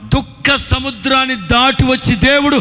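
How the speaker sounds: loud, high-pitched fervent proclamation; preaching at full force. Real, not acted. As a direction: declare the blessing over the crowd with full power.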